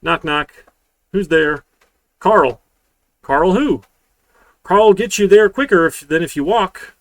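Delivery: loud, high joke reading; excited joke telling. Acted. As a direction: ham up the knock knock joke. Reads knock knock joke, loud.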